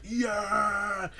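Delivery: high excited cheer; cheering crowd. Retold or imitated.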